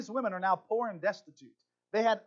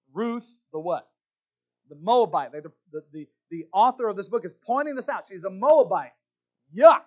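scornful label; 3 semitones higher, 14 dB louder than usual. Pretend. Preacher mocking the label Moabite.

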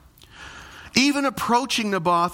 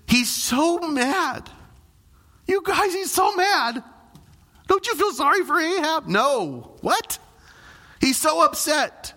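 high, mock-pitying whine over a sulking king; sing-song pity, tongue in cheek; mock sympathy; 9 semitones higher than usual. Preacher mocking sulking Ahab to the church, high mock pity.

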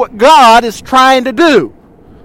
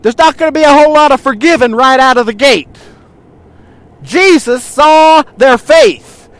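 usual speaking voice

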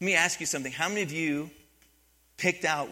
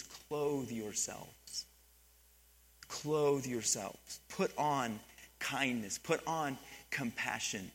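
slow, soft and deliberate, each word placed; slow emphasis; 3 semitones lower, 6 dB quieter, slower than usual. Shown as a demonstration. Pastor savouring the scripture verse, slow and soft. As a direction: let each phrase land slowly. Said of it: "I like the way he says this".

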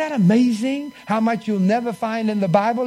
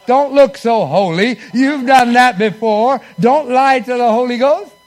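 preacher calling out; 4 semitones higher, 9 dB louder than usual. Acted. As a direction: call them out with a grin.

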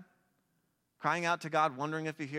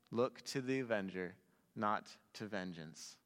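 softer and lower, slowing on the last words; soft takeaway; 5 semitones lower, 7 dB quieter than usual. Real, not acted. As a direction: let the main idea land gently. Preacher's quiet key takeaway.

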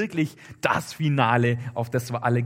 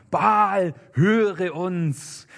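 loud, high drawn-out invocation; calling on a god; 5 semitones higher, 4 dB louder, much slower than usual. A narrator's reading. Baal's priests calling on their god, raised drawn-out call. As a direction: cry out slowly to Baal, pitched up. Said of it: "Dann riefen sie den Namen Baals an".

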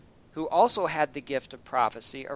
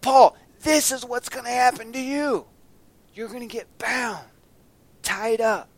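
prophet warning; 8 semitones higher, 8 dB louder than usual. Retold or imitated. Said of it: "And so he's".

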